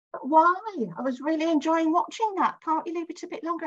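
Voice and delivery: mother, reluctant to leave the gallery, high and plaintive; old mother protesting. Retold or imitated. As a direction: play the puzzled old mum who wants to stay. Elderly mother voiced, protesting.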